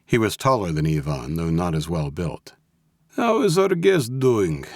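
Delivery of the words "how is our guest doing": high, slow, falsely pleasant Russian; pleasant mob boss. A narrator's reading. Mob boss asks after his captive, pleasantly. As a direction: ask it pleasantly, drawn out and pitched up. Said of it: "asked Malensky pleasantly".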